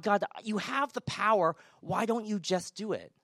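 a touch of frustrated protest; agitated with God. Acted. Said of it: "get agitated with God".

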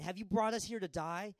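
high, loud grumbling protest; complaining crowd. Acted. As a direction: whine like a fed-up crowd. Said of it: "the people began to complain".

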